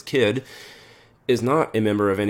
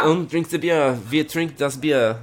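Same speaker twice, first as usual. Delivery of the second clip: higher, slow mock German, laughing; fake German accent; 6 semitones higher, slower than usual. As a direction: joke German beer-hall voice. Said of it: "fake German impressions".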